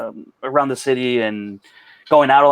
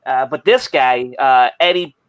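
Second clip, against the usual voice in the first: thick, exaggerated Chicago accent. Shown as a demonstration. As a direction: lay on a heavy Chicago sports-fan accent. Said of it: "I'll do my Chicago accent".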